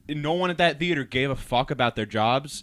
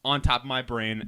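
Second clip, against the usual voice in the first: ordinary delivery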